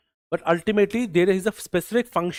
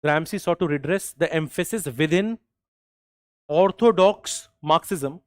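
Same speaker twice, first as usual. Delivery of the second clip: slow dictation; slower than usual. Shown as a demonstration. Teacher dictating a quote.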